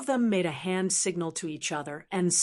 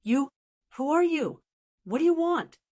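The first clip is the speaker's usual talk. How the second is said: trembling voice; 7 semitones higher than usual. Acted. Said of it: "said in a trembling voice".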